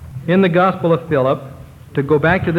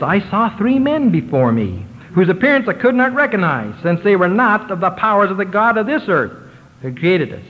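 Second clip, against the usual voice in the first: raised, earnest reading of ancient words; elevated scripture reading; 5 semitones higher than usual. Acted. Preacher reads Adam's vision to the congregation, raised and earnest. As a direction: lift the voice as if reading revelation.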